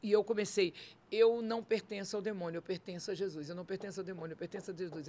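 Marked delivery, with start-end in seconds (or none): 1.1-5.1 low, very soft repeated declaration; murmured prayer. Retold or imitated. Praying to God before facing death, low murmured chant. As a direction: chant it under your breath, low and hushed.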